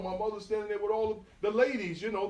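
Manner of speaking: usual speaking voice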